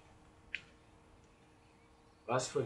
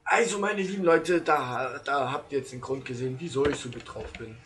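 youtuber outro; 3 semitones higher, 6 dB louder than usual. Acted. Outro address to viewers.

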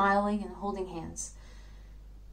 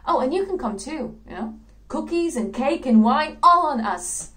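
higher, louder party invitation in character; cheery risen dead; 5 semitones higher, 5 dB louder than usual. Acted. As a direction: invite everyone along as the revived player. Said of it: "go to the guy that killed them and say".

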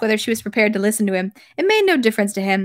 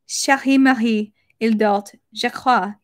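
French. Acted. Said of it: "said Sonia".

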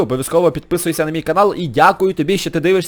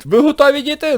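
playful bus captain; 10 semitones higher, 3 dB louder than usual. Pretend. Plays bus driver to kids.

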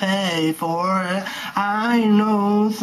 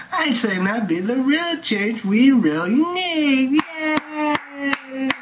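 mock crying; 8 semitones higher, 3 dB louder, slower than usual. Cry heard, high.

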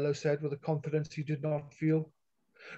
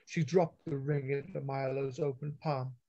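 plain reading voice

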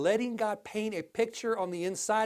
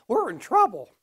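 emphatic warning; 3 semitones higher, 6 dB louder than usual. Real, not acted. Preacher punch line.

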